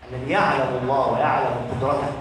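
usual speaking voice

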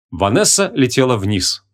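plain reading voice

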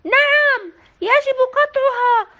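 loud, high يجب قطعها, stress on يجب; crowd shout. A narrator's reading. Villagers agreeing with mayor, loud shout. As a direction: shout it together as a mob. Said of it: "هنا صاح الجميع".

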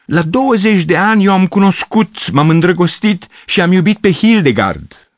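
high, loud melodramatic confession; pathetic exclaiming. Acted. Gavrilescu telling the girls of Hildegard, melodramatic. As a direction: confess lost love with theatrical pathos. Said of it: "exclamă patetic".

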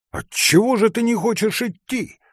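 high, loud, exasperated question; urgent demand. A narrator's reading. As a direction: press him for an answer. Hunter urges reluctant forester.

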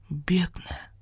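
soft, tearful sniffle; a small, teary whimper over the hen; sobbing. A narrator's reading. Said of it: "всхлипнул барсукот".

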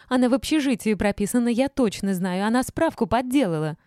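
high, loud, heated accusation; accusing yell. A narrator's reading. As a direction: shout your case against her. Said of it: "заорала Ольга".

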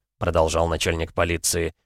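usual speaking voice

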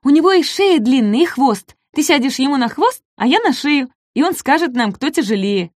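high, bright small voice; eager little dinosaur. Acted. Ком объясняет Даку план, оживлённо. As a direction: explain the plan keenly.

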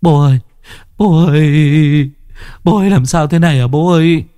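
keen for a lost parent, high and stretched; loud crying wail. A narrator's reading. Children run to their dead father, bawling. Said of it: "gào khóc inh ỏi".

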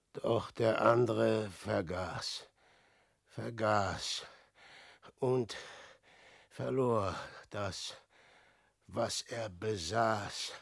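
falling phrases with long pauses, dying away; desolate. Acted. Man realises his lost realm, desolate.

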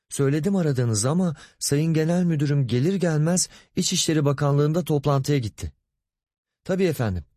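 high-pitched, courteous secretary on the phone; polite, apologetic secretary. Acted. Secretary answering the phone, excusing boss. (kibar, mahcup sekreter sesi)

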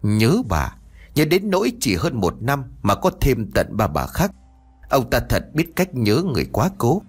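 ordinary delivery, nothing marked

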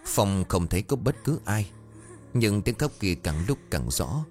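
plain reading voice

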